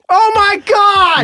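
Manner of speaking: high, drawn-out shout of disbelief; shocked shout. Real, not acted. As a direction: yell it out in disbelief. Shocked outburst at game play.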